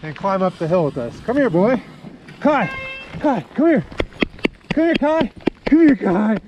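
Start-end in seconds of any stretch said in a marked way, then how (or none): 1.2-6.4 calling from far away. Real, not acted. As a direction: call out to the running dog. Calling dog back.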